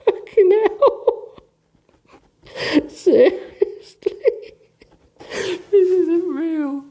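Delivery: slow, high, laughing disbelief; incredulous laughing outrage. Real, not acted. Reader appalled by the book, slow laughing disbelief. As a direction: howl in disbelief at the page, laughing.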